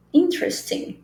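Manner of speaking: "Interesting" as in-tres-ting, pitched high; silent middle E. Shown as a demonstration. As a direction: skip the first E of "Interesting". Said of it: "esse é aqui é mudo".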